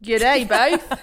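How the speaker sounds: mock Australian accent. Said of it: "We're getting worse".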